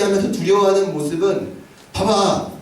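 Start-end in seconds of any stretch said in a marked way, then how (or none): none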